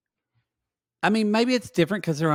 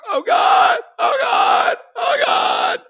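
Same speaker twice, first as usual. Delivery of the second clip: mock panicked shout; 8 dB louder, much slower than usual. Mock panic over Georgie spiral.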